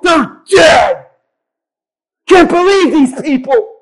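hot-tempered fisherman shouting revenge; angry disciple. Retold or imitated. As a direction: rage like a son of thunder.

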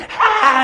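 bright little high-pitched mock cry; mimicked high "ah". Pastor mimicking his twenties wife getting up, high mock cry. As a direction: imitate a sprightly wife with a high "ah".

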